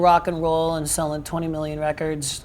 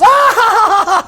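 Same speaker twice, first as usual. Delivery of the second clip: imitated high soprano laugh; very loud, shrieking high cackle; 13 dB louder than usual. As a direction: burst into a piercing mock laugh. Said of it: "This high fucking laugh".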